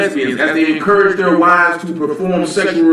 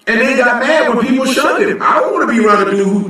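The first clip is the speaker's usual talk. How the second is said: indignant shout; 6 semitones higher than usual. Real, not acted. Indignant preaching rant.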